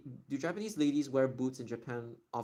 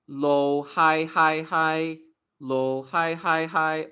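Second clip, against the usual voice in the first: pitch accent demo; 3 semitones higher, 9 dB louder, much slower than usual. Shown as a demonstration. Showing viewers pitch pattern, chanted tones louder. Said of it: "Why am I speaking Cantonese right now".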